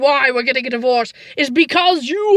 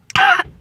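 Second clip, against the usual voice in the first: mock dying cough.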